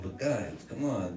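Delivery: shy protest, barely pushing back; bashful reluctance. Retold or imitated. Reluctant nephew to the guys before the fight, bashful. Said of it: "real reluctant, all bashful, toes in the dirt".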